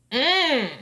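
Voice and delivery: ordinary delivery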